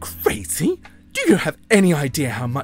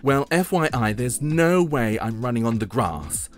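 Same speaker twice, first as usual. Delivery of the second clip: sassy refusal; 3 semitones higher than usual. Acted. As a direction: announce it with haughty indignation. Haughty fashion girl refuses.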